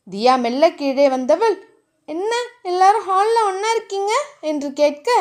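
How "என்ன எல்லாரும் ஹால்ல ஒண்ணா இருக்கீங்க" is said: higher, sustained questioning voice; curious young woman. A narrator's reading. Woman asks family gathered in hall, curious.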